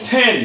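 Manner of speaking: টেন as "then" with a puff on the t; aspirated English t. Shown as a demonstration. Teacher models ten for pupils, aspirated t. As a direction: breathe out hard on the t of টেন. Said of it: "not টেন".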